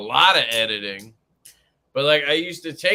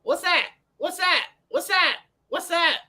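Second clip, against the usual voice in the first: put-on high little-kid voice, repeating; imitating toddler. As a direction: squeak it like a curious toddler. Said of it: "all she says now is".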